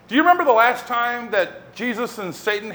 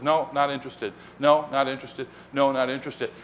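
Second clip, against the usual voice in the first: low, softer, unmoved repetition; dismissive refusal; 6 semitones lower, 6 dB quieter than usual. Retold or imitated. Jesus refusing the tempter every time, dismissive and low. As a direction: turn it down evenly, without interest.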